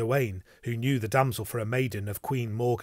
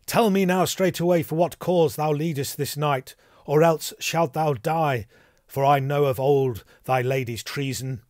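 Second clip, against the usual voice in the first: raised, louder demand under threat; stern threat; 4 semitones higher, 6 dB louder than usual. A narrator's reading. Gawain threatens the damsel with his sword, sternly demanding. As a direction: demand it forcefully, sword drawn.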